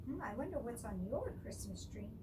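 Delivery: ordinary delivery, nothing marked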